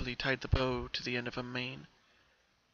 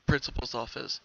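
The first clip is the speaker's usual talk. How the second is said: ordinary delivery, nothing marked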